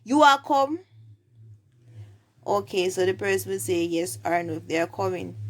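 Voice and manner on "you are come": high, loud Jamaican Patois question. Shown as a demonstration. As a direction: ask it in Patois with raised pitch. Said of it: "you say".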